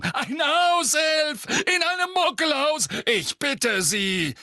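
loud scoffing official; pompous mayor. A narrator's reading. Fudge scoffing loudly.

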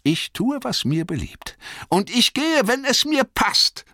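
high, stubborn retort, rising in force; Bilbo, beharrlich. A narrator's reading. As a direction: dig in defiantly, pitched high. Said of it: "beharrte Bilbo". (trotzig beharrend, hohe Stimme)

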